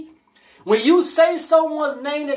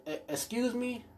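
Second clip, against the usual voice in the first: soft incredulous; 16 dB quieter than usual. Retold or imitated. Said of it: "I said".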